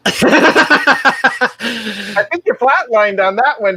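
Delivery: deadpan know-it-all jab at the professor; lightly voiced nerdy kiss-up; nerdy student quote. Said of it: "that one nerdy guy was like".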